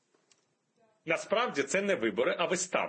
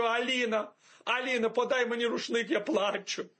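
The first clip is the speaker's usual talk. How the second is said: higher-pitched, put-on sentimental plea; mock weepy morning call; 6 semitones higher than usual. Waking up and calling Alina for a towel, mock weepy. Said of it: "Я кожного ранку прокидаюся і кажу".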